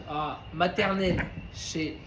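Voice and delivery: ordinary delivery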